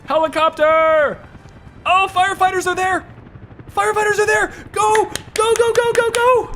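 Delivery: high-pitched urgent excitement; frantic cheering. Real, not acted. Player urging firefighters to the blaze, frantic excitement. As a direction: yell them on, pitch way up.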